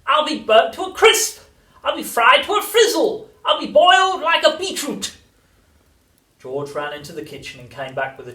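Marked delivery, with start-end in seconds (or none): 0.1-5.2 high, wailing screech of outrage; hammed-up frantic grandma at full volume; old woman yelling. A narrator's reading. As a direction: shriek each complaint louder than life. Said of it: "she yelled".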